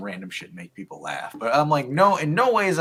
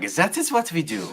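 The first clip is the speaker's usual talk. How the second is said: ordinary delivery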